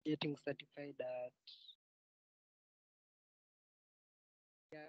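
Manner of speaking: very faint, far from the mic; almost inaudible speech; barely audible. Real, not acted. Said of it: "Your voice is very faint".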